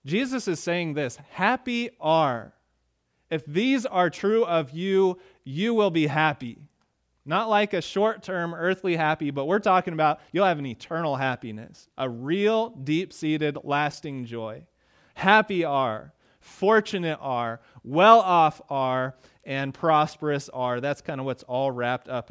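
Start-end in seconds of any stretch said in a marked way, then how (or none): 1.3-6.6 slow, deliberate and a bit louder; preacher recasting blessed as happy; slow, savoured paraphrase. Acted. Paraphrasing the beatitude. 15.1-20.8 slow, louder, weighing each synonym; preacher piling up synonyms for blessed; slow emphatic list. Acted. Stacking synonyms.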